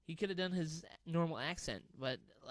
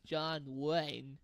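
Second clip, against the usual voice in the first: slow, drawn-out cowboy drawl; John Wayne drawl; slower than usual. Shown as a demonstration. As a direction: drag each word like an old western star. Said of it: "I talk like John Wayne".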